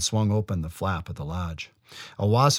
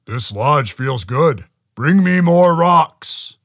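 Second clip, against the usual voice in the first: slow, loud, commanding giant; booming giant; 7 semitones higher, 11 dB louder, slower than usual. A narrator's reading. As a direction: boom out the order. Giant demands rocks.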